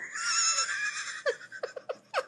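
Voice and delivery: bursting into a high shrieking laugh; loud high laugh. Real, not acted. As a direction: let out a big cackle. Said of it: "I'm getting a kick out of this".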